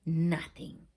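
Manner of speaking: usual speaking voice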